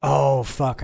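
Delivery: frustrated outburst. Real, not acted. Mistake made mid-cast.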